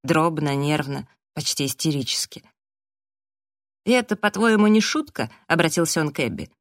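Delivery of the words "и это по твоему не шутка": higher, louder, agitated question; incredulous challenge. A narrator's reading. Raf turning to Abby, agitated incredulous challenge. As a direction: throw it at them in disbelief.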